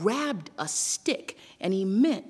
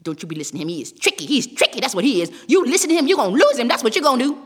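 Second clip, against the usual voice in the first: mad fox; 2 semitones higher, 8 dB louder, much faster than usual. A narrator's reading. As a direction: start grumbling, build to loud nagging. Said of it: "Brer Fox was mad".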